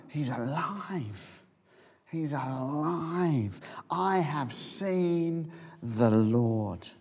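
slow, soft, wondering; hushed joyful testimony, drawn out; awed, tender. Acted. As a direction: savour each word like good news. Mary's joyful announcement, slowed.

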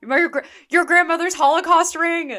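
loud, high shocked outburst; real disbelief. Real, not acted. Podcaster reacting to Fiona keeping the ring, loud shock.

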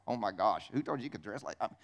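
low, hushed mock disapproval; appalled onlooker. Preacher mimics people judging someone's clothes, low mock-appalled. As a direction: drop low and soft, scandalised by what you see.

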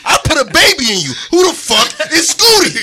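hammed-up rage at the girlfriend; mock jealous outburst. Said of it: "I'm gonna say while he's there".

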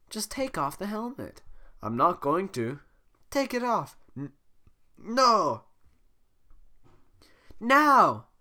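high, loud insistent commands; demanding. Acted. Fiona demands, Shrek refuses.

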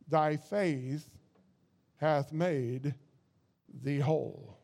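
slow, hushed words of Jesus; quiet, slow and reverent; solemn quote. Retold or imitated. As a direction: let it fade to a hush at the end. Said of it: "He said".

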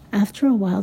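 usual speaking voice

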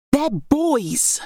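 high, spat-out contempt. A narrator's reading. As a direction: spit the word out in disgust. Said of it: "Berenice spat the word".

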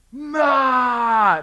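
high, loud mock war cry; battle yell. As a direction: let out a hammed-up charging yell. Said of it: "was just like".

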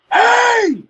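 high, loud imitating yell from far off; hollering across the hall as the giant guy; mock yell. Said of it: "he just yells at us".

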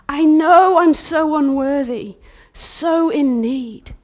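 loud, high and slow lament; loud pleading bride; anguished plea. Acted. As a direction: cry out your unworthiness. Bride voiced crying out unworthiness.

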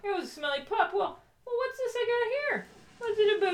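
squeaky high playful voice; high mock voice. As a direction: pitch way up, teasing. Mock high voice presenting book.

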